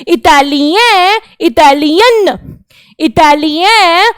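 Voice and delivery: इटालियन drawn out slowly, the French way; slow French demo. Shown as a demonstration. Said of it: "ट तो हम लोग बोलेंगे ही नहीं".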